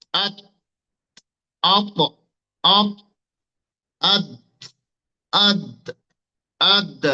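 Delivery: "আত", "অপ", "আদ" each short, stopped on the final consonant; sukun drill. Shown as a demonstration. Teacher drilling students on where the tongue rests, sukun drill. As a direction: punch out "আত", "অপ", "আদ" singly, holding the closing consonant, with pauses. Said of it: "বোঝার জন্য সাকিন দেন".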